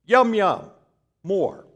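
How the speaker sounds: high, slow pidgin English for the waiter; simple broken English. Retold or imitated. Husband speaking pidgin English to a waiter. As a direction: keep it childishly simple, yum yum. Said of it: "I'm just trying to keep it simple".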